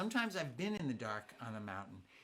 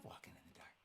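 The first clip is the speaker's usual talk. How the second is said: whispered; 20 dB quieter, faster than usual. Acted. Whispering about the dark.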